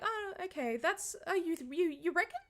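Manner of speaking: high, mock-clueless voice; lightly high-pitched mock coworker; mocking naive coworkers. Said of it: "everyone else was like".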